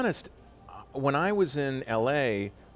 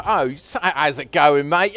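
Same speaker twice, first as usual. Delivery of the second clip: louder, higher Cockney greeting; Cockney accent; 5 semitones higher, 9 dB louder, slower than usual. Acted. Showing the host what Cockney sounds like, loud Cockney accent. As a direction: belt out a cheery Cockney hello. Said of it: "But Cockney's quite".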